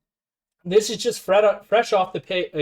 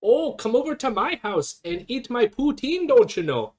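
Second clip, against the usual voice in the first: hammed-up sitcom Canadian accent, pitched higher; 7 semitones higher than usual. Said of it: "sounds like a prototypical Canadian".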